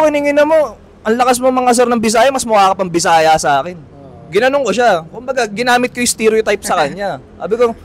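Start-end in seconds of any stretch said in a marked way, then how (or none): 0.0-3.8 raised, higher comeback; retold angry comeback. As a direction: snap back at the bully, louder. Speaker retells his comeback.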